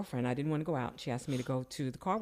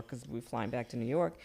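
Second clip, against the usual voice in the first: usual speaking voice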